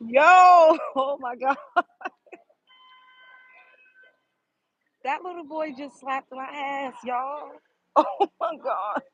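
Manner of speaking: high, shocked and giggling; nervous laughing. Real, not acted. Telling viewers a little boy slapped her, nervously laughing. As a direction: laugh in disbelief at what just happened. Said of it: "I'm laughing because I don't know how else to react".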